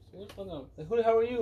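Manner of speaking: high-pitched retold stranger; mock bafflement. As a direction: imitate an indignant passerby, lightly. Retold baffled strangers challenging Tony Hawk.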